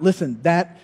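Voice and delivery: ordinary talk, nothing marked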